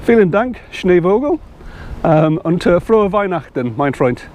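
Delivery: rusty German with an English speaker's effort; attempted German. Shown as a demonstration. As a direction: have a careful go at German. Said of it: "That's my, uh, attempt at German".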